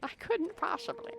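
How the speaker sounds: very high, soft mock voice; mimicking a game character. Streamer echoing a game character's refusal, high soft mimicry. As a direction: parrot it in a tiny high voice.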